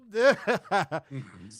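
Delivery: loud, gleeful laugh; hearty laugh. Real, not acted. Host tickled by his Vegas boast, hearty laugh.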